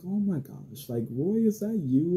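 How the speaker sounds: high, bright surprise at the voice; surprised. Retold or imitated. Surprised girlfriend hearing her ex on the phone, high and excited. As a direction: gasp it out like you just recognized him.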